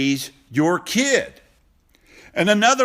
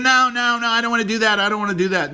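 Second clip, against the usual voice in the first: higher, whiny wife refusing; mock wife; 5 semitones higher, faster than usual. Husband imitating his wife refusing to exercise, higher mock whine. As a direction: play the reluctant wife pushing back, a notch higher. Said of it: "She's gonna".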